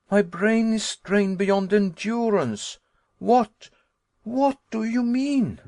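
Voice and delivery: high, loud, strained to breaking; overwrought cry. Acted. Nephew begging uncle to explain, high and distraught. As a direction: mind at its limit, demanding answers. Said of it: "I cried".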